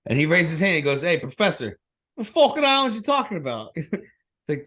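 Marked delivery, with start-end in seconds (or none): 1.0-3.8 high, indignant Boston classmate; Bostonian accent. Retold or imitated. Classmate questioning the professor, indignant Bostonian accent. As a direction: challenge the teacher in a mock Boston accent. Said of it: "having a Bostonian classmate".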